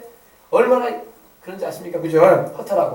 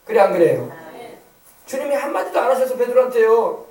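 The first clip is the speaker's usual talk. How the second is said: ordinary delivery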